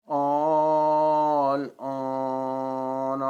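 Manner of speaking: آل آن recited very slowly, opening "aa" held long; long madd. Shown as a demonstration. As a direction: sustain both vowels of آل آن on a level note. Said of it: "مد کے ساتھ پڑھنا". (آل آن لمبی مد کھینچ کر)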